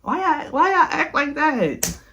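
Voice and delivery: high-pitched, drawn-out exasperated plea. Retold or imitated. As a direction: whine it in mock despair, stretching it out. Repeats exasperated complaint about men.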